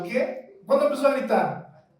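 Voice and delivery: normal talking voice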